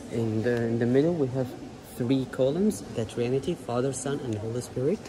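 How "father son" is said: normal talking voice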